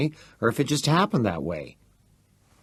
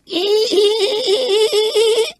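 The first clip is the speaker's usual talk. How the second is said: high sung cackle rising; singsong mocking laugh; 7 dB louder than usual. A narrator's reading. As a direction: laugh it like a taunting tune.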